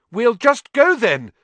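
high, loud desperate call. A narrator's reading. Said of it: "Harry called desperately".

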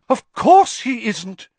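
high, loud, thrilled seer; gushing with excitement; excited Trelawney. A narrator's reading. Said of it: "looking thoroughly excited".

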